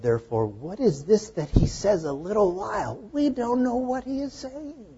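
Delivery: high, puzzled disciple voice; confused disciples. Retold or imitated. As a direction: ask it high and baffled. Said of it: "they said".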